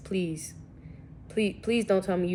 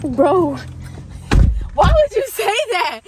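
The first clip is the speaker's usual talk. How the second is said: mock outrage; 12 semitones higher, 13 dB louder, slower than usual. Real, not acted.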